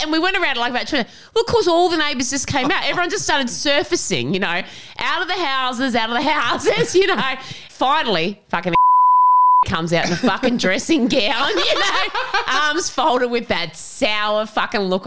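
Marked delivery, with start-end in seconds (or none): none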